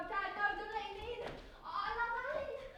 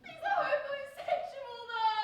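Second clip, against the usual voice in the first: wailing protest; 4 semitones higher, 9 dB louder than usual. Acted.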